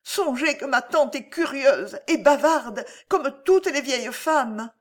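high, urgent explanation; anxious warning. A narrator's reading. Alice warns the count about her prying aunt, anxious warning. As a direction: warn him urgently in a high voice.

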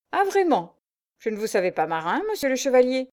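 high mocking sneer; ironic sneer. A narrator's reading. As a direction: scoff at him. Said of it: "ricana ironiquement". (ricanement ironique, plus aigu)

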